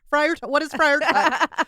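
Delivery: laughing disbelief. Real, not acted. Mocking her slip, laughing.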